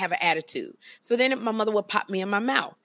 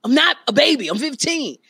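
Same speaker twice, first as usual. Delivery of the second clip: loud, higher mock groan of protest; exasperated teenager; 6 semitones higher, 7 dB louder than usual. Mother imitating her son over baby pictures, loud exasperation. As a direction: imitate an embarrassed teen snapping back. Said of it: "He was like".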